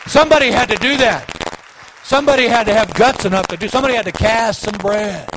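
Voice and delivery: loud, high, driving sermon cry; fiery revival preaching; full-force preaching. Acted. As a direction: hammer each somebody home to the church.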